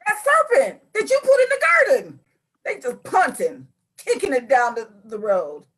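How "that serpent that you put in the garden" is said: very high, loud mock protest; blame-shifting Eve. Co-host mimics Eve blaming the serpent, high mock indignation. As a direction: wail the blame onto someone else, hammed up.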